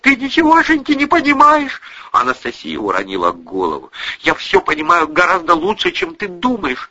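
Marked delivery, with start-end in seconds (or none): 0.0-1.8 high, loud, upset mother; angry retort. A narrator's reading. Mother retorts to accusing daughter, angry. 4.2-6.9 higher-pitched, defiant daughter. A narrator's reading. Daughter stands up to mother, defiant. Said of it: "сказала Лиля с вызовом".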